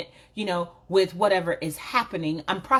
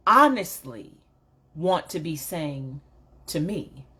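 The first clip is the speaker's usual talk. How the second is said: ordinary talk, nothing marked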